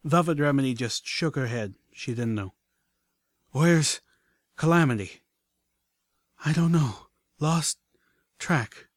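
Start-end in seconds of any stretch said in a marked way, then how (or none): 3.5-5.2 panting. Acted. Exhausted, panted exchange goes on. 6.4-8.9 high, broken into short breathy bursts; out of breath. Acted. Exhausted companion admits losing track of friend, out of breath. Breathless answer after the escape.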